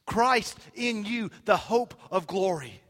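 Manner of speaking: loud, high preacher's cry, slow at first; triumphant proclamation. Real, not acted. Preacher climaxing on Paul's verse, loud and triumphant.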